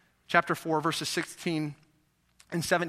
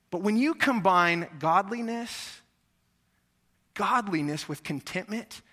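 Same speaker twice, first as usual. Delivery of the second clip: ordinary delivery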